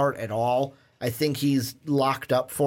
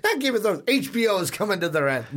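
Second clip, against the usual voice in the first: laughs through a higher self-correction; giggling as he fixes his mistake; amused correction, laughing; 5 semitones higher, faster than usual. Real, not acted. Corrects his own slip, laughing.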